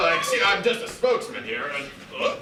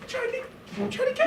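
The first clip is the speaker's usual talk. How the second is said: high squealing; 5 dB quieter, much faster than usual. Acted. High squeal, cat biting.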